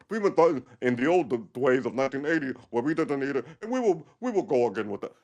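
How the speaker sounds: put-on old pensioner voice; old Russian lady. Pensioner recalling the old country, old lady voice. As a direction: play a stubborn Russian old-timer. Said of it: "Sixty-five-year-old ladies".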